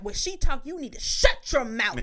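a hint of a stern father; imitating stern dad. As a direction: defend the mother, laying down the law. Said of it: "He was like".

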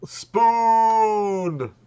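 high, loud, long-held holler; drawn-out comic charging yell; mock battle cry. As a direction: bellow a silly war cry.

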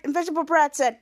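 usual speaking voice

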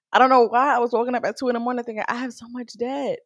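high, fast anxious rush; mock panic. Herself panicking about debt at night, high and fast. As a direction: race through the worry.

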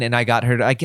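ordinary talk, nothing marked

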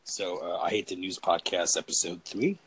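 low, tired and listless; flat and drained, barely trying; low energy. Real, not acted. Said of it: "like, low energy right now".

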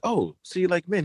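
put-on British accent. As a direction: ask it in a playful British accent. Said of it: "I'm talking in an accent".

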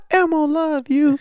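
high, slow Elmo squeak. Retold or imitated. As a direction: do the Tickle Me Elmo voice. Imitating Elmo.

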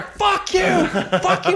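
very high-pitched mock yell. Kid yelling back at a comic who feigns deafness, escalating mock yell. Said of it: "he would yell louder".

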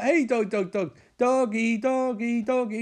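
calling a dog. Retold or imitated. Man tempting a stray with food, high coaxing call. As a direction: lure the dog back, voice held high.

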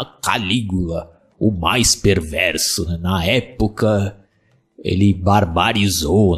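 low, slow, ominous trailer narration; dramatic horror-trailer announcer; movie trailer voice. Retold or imitated. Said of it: "O cara falava".

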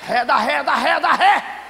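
loud, high, rising repeated yell; hammed-up panicky order to reverse; frantic mock command. Woman ordering her tears into reverse, comically frantic yelling.

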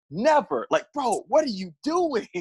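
high, incredulous rant at a fighter; exasperated outburst. Real, not acted. Cohost rants about the inhaler, high and incredulous. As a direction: voice jumps high in disbelief.

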